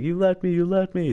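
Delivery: high-pitched mock yell at a traitor; wounded man yelling at the leader who left him, imitated; retold angry yell. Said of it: "he was like".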